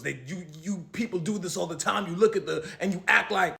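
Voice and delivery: mildly mock the clerk's accusation; lightly voiced accusing worker.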